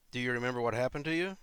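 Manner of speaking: usual speaking voice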